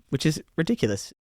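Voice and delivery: normal talking voice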